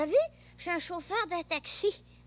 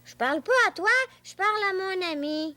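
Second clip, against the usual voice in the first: irritated retort; 3 semitones higher, 8 dB louder, faster than usual. Acted. Boy rebuffs father.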